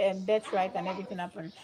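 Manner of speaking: usual speaking voice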